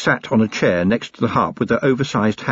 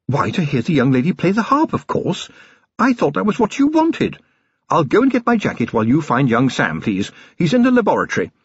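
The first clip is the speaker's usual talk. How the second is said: higher-pitched wife feigning innocence; affected surprise; 6 semitones higher than usual. A narrator's reading. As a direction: act as if it were obvious all along. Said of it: "Sybil affected surprise".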